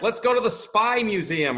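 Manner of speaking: put-on high, loud excited child; eager kid voice. Imitating his eager kids.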